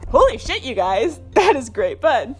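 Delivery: ordinary talk, nothing marked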